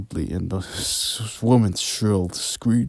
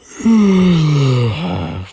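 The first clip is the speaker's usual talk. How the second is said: loud sigh; 8 semitones higher, 10 dB louder than usual. Acted. Manager's loud weary sigh.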